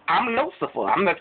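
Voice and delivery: high, showy self-praise; boastful brag. Acted. Lucifer bragging to God about his diamonds, high and showy. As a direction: puff yourself up.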